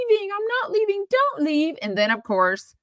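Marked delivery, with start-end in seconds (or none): 0.1-1.8 higher, hammed-up back-and-forth; mock drama. Vlogger mocks the housewives' exit squabble, lightly hammed-up. Mocking the front-door drama.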